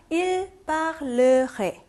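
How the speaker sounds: parlerait said slowly, syllable by syllable; pronunciation model. Shown as a demonstration. Teacher demonstrates 발음 for students, slow syllable-by-syllable model. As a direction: sound out parlerait slowly with every syllable separated. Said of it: "발음해볼게요".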